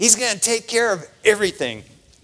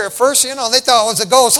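ordinary talk, nothing marked

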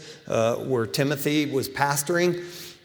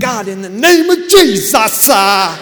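much louder, higher hammed-up pleading prayer; greedy believer praying for a Porsche, hammed-up loud plea; mock prayer; 6 semitones higher, 10 dB louder than usual. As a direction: beg God for the car, overblown.

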